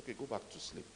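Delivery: soft, low murmur, letting her rest; gentle hush. Retold or imitated. Preacher softly telling the drowsy girl to sleep. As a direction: quietly send her back to sleep.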